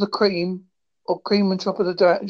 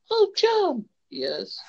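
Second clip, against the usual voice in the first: hammy soap acting; 8 semitones higher, 3 dB quieter than usual. Parody of badly scripted show.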